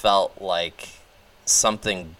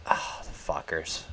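muttered curse; 3 semitones lower, 9 dB quieter than usual. Real, not acted. Cursing the team under breath.

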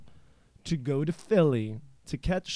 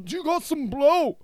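imitating the singer higher; high mock voice; 12 semitones higher than usual. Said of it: "Waddy standing there going".

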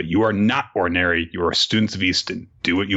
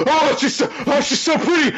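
put-on swooning girl. Rescued girl swooning over Barrett, high and gushing. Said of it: "she's like".

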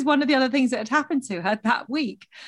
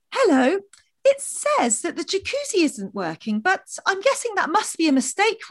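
high, sweetly passive-aggressive voice; overly polite, sugary and pointed; patronising passive-aggressive; 6 semitones higher than usual. Retold or imitated. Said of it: "this kind of patronising, passive-aggressive voice".

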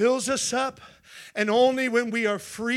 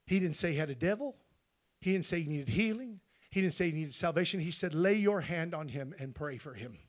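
soft, low reverent retelling; softer voice for the Holy Ghost's words; hushed; 4 semitones lower, 10 dB quieter than usual. Acted. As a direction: draw them in softly. Quiet retelling of God's words.

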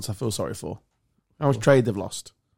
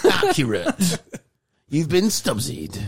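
game show host; 3 semitones higher, slower than usual. Pretend.

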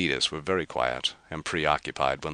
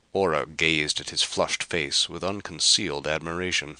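ordinary delivery, nothing marked

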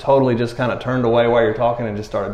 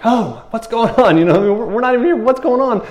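high, flustered bystander voice; mock panic; 8 semitones higher than usual. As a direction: play a rattled crowd in a high pitch.

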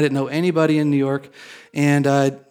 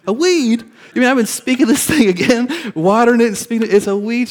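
very high, louder, laughing outrage; shocked disbelief; 8 semitones higher, 4 dB louder than usual. Retold or imitated. Told it's a weed again, loud high laughing disbelief. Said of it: "I'm like".

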